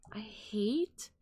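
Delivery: ordinary talk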